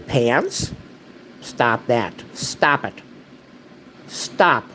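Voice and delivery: firm, rising commands; stern owner to misbehaving dog; scolding the dog. Real, not acted.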